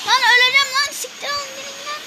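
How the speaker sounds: high-pitched panicked yelling. Real, not acted. Player yelling at attackers in game, high-pitched shouting. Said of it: "bağırma, bağırma".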